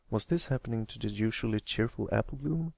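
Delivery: ordinary delivery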